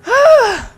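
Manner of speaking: loud, high groan falling away; high falling sigh of frustration; exasperated groan. Real, not acted. Player fed up with monsters popping up, exasperated groan.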